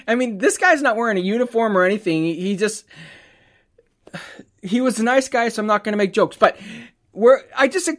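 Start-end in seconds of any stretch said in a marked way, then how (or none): none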